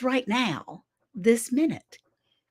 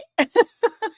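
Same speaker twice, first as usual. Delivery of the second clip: high laugh; 12 semitones higher, 5 dB louder than usual. Real, not acted. Laughs after her mock greeting.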